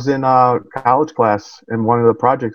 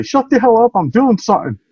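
retold yell; 10 semitones higher than usual. Student yelling at noise outside his room, high mock yell. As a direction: snap it out like you're fed up with the noise. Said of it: "I'll yell and say".

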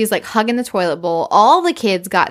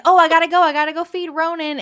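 put-on higher-pitched hurried child; flustered child; 8 semitones higher than usual. Girl cutting short the call to feed Ronan, flustered. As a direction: imitate a busy kid in a high voice. Child retold hurrying off phone.

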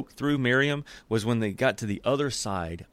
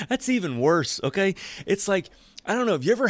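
laughing; 9 semitones higher than usual. Real, not acted. Laughing aside.

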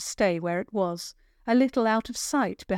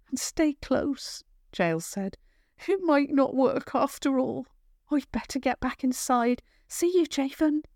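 put-on higher, sure girl's voice; confident girl; 6 semitones higher than usual. Kale confidently assigning Javan the final rescue. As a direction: say it like the plan is settled.

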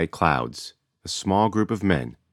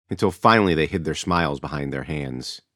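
ordinary talk, nothing marked

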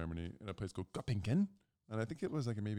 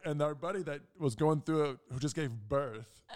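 usual speaking voice

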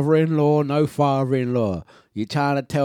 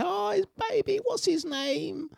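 mock coworkers; 7 dB quieter than usual. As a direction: imitate coworkers cooing in a high voice. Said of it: "I'd say".

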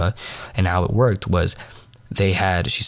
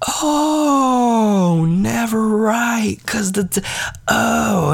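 high, drawn-out gasping oh; hammed-up high-pitched epiphany; loud realization; much slower than usual. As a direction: gasp and draw it out as it dawns. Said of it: "then I realized it out loud".

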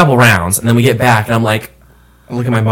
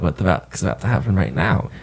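low, hushed private thought; inner-thought aside; 5 semitones lower, 10 dB quieter than usual. Retold or imitated. Wary man pulled aside by a stranger, thinking it quietly. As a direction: drop to a soft mutter, as if only thinking it. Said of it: "I said it in my head".

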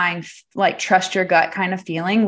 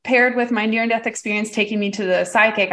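ordinary delivery

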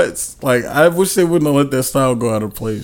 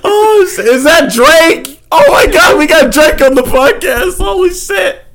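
high, loud excited shouting; 12 semitones higher, 4 dB louder than usual. Real, not acted. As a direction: hype it up like a celebrity arrived. Excited reaction to [name] impression.

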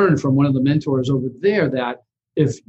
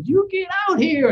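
much higher mock shooing; retold tenant, much higher; imitating the tenant. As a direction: send them out in a much higher put-on voice. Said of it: "She goes".

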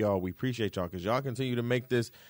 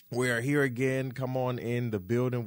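ordinary delivery

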